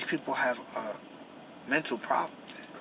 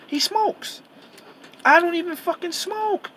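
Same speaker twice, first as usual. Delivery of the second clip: much higher, loud exasperated shout; real angry outburst; 12 semitones higher, 7 dB louder, slower than usual. Real, not acted. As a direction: blow up in frustration at the injustice. Angry rant about unfair treatment.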